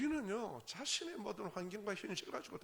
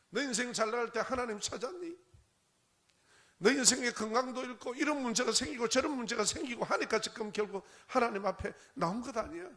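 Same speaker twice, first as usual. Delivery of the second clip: higher-pitched reproachful questioning; insistent, raised preaching voice; pointed challenge; 7 semitones higher, 5 dB louder than usual. Acted. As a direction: confront them as God calling out a believer. Voices God challenging the believer.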